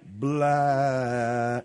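low word stretched extremely long. Shown as a demonstration. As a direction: hold the vowel like slow motion. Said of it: "we need it slower".

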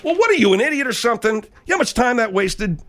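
high-pitched irritated telling-off; exasperated scolding. Acted. Host scolds staffer over wrong call.

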